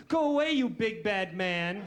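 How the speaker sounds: hammed-up, harmless road rage; mild mock scream. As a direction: yell it half-heartedly, drawing it out. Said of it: "the vilest thing I could scream".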